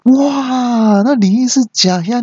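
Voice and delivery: high, long mock wail of shock; astonished cry. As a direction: cry out in exaggerated amazement. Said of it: "我就惊讶的大叫说". (惊讶地拉高嗓门大叫)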